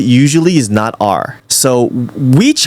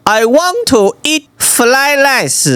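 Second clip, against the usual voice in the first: slow, high, exaggerated Chinese accent; 11 semitones higher, slower than usual. Shown as a demonstration. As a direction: demo a thick Chinese accent, drawn out and high. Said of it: "try to do the accent".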